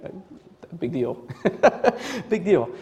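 unimpressed, amused mutter; dismissive listener. Acted. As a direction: brush it off, half laughing. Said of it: "some of you here this morning might be going".

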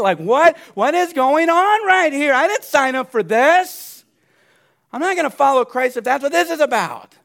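high, loud, indignant outburst; loud affronted bondservant complaining; outraged protest. Acted. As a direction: protest like a slave who's been wronged.

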